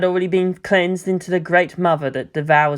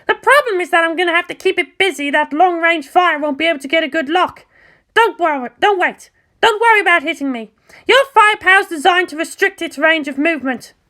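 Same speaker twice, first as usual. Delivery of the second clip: put-on higher, cocky, blunt girl voice; brash pilot Asuka; 13 semitones higher than usual. Asuka telling Mayumi it's not her battlefield, higher brash voice. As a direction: voice Asuka high and sure of herself, talking down.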